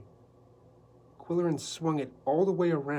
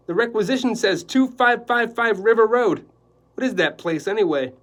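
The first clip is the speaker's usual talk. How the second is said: a brash, cheery photographer, much higher and louder; 8 semitones higher, 12 dB louder than usual. Acted. Photographer calling Quillerin about the job, loud and brash. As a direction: boom it down the phone line like a pushy newsman. Bunsen continues.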